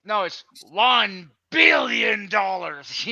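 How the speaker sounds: slow shouted evil boast; shouted villain demand. Pretend. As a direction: bellow the sum like a movie villain. Villain ransom demand joke.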